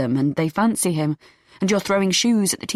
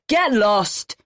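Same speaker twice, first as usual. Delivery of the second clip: shout; 3 semitones higher than usual. A narrator's reading. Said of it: "looks over his shoulder and shouts".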